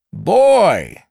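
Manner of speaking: put-on loud roaring Boy; roared. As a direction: bellow it into a deaf ear. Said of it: "roared John".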